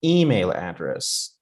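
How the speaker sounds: "Email address" slowly, EMAIL drawn out; exaggerated first-word stress. Shown as a demonstration. Teacher exaggerating so students understand, "Email" stressed in "Email address". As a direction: lean hard on "Email", drop off on "address". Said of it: "trying to a little bit exaggerate".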